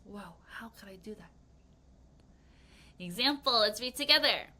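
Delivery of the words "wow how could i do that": whispered in awe; amazed whisper. Pretend. Martina whispering in awe after reaching the finals. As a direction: breathe it out in disbelief. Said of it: "She's like".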